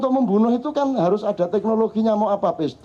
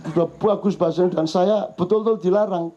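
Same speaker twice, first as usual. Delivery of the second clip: usual speaking voice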